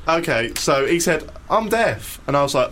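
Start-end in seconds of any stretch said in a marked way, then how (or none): 1.5-2.2 put-on a light, slightly higher voice for the old man; imitating the old deaf man. Telling mates the old deaf man's words, lightly voiced higher. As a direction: mimic him gently, a little higher up. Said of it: "Actually, no, slightly higher".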